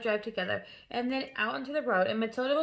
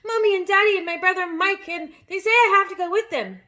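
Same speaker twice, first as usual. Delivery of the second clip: frantic; 9 semitones higher, 10 dB louder than usual. A narrator's reading. Child still frantic.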